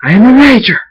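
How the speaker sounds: high, loud battle cry; roaring, full-force proclamation; triumphant scream. A narrator's reading. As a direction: scream it out as a declaration. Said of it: "raises his wings and screams".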